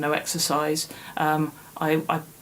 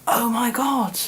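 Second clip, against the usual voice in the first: amazed realisation; 5 semitones higher, slower than usual. Retold or imitated. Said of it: "And I was like".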